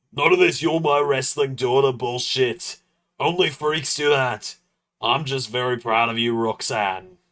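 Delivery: rough, barely shifted Drew impression; a loose take on Drew, mostly his own voice. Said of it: "Spot on Drew impression".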